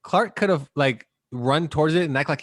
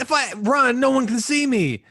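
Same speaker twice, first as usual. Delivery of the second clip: higher, louder mock line; mock Superman line; 9 semitones higher, 4 dB louder than usual. Mocking Superman's line about running, higher and louder. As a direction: ham up the hero's words.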